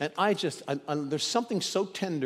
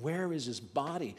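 grieving question; 7 dB quieter than usual. Acted. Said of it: "still weeping and grieving and wondering".

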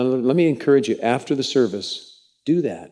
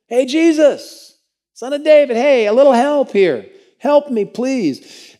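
much higher, louder, slow roadside cry; calling out; 12 semitones higher, 7 dB louder, slower than usual. Acted. Blind beggar shouting at Jesus passing, high loud cry. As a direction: yell for help over the crowd.

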